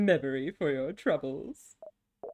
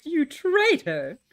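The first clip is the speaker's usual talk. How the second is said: mock accusation; 7 semitones higher, 6 dB louder, slower than usual. Melodramatic accusation at Stardust.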